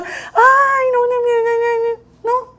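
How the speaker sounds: high, slow, hammed-up whimpering no's; mock crying whine. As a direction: play the self-pitying crybaby, dragging each no. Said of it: "si yo me pongo a pensar mal".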